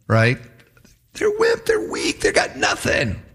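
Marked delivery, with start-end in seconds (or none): 1.1-3.2 high schoolyard taunt, dropping to a whispery finish; name-calling. Acted. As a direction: sneer it like a playground bully. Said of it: "Name calling".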